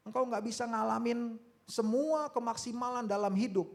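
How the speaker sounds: high, loud exhortation; forceful preaching. Real, not acted. Preacher warning congregation about missing life's fullness, forceful preaching. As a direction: proclaim it to the whole congregation.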